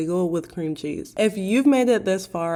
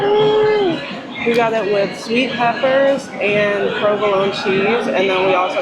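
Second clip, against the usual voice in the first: high delighted ooh, louder and slow; excited; 3 semitones higher, 8 dB louder, slower than usual. Real, not acted. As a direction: gasp with delight at the food. Seeing the Italian beef.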